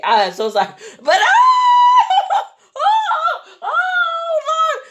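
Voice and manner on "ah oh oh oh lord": high, loud hammed-up wail; screaming. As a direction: shriek and wail in mock terror. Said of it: "I'm doing all that".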